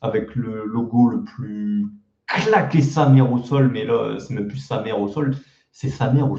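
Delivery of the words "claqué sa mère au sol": CLAQUÉ stretched out and hit hard; slow, heavy punch on "claqué"; scornful emphasis. Real, not acted. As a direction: drag out "claqué" with comic disgust. Mocking the terrible old logo.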